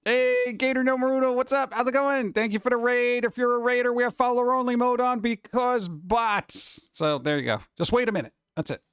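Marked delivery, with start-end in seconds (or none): 0.0-6.9 upbeat greeting. Real, not acted. Greeting a raider on stream.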